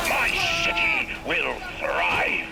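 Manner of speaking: high, slow triumphant cry; triumphant proclamation. Acted. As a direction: proclaim it to the heavens slowly. Villain proclaims his city.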